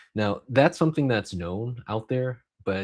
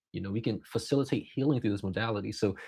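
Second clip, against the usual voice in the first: ordinary talk, nothing marked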